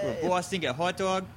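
put-on Boston accent. Players riffing on Boston at the table, Boston accent. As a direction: lay on a joking Boston accent. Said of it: "a Boston accent".

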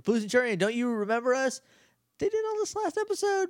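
high-pitched mock-earnest plea; mock pleading Rangers. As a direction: whine it like distraught young heroes. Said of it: "The Power Rangers are like".

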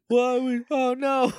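high, whimpering mock dismay; mock panic. Recapping the Rangers watching Mordecon squeeze through, mock panic. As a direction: whine it like helpless heroes.

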